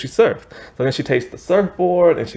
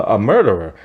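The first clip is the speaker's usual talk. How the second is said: usual speaking voice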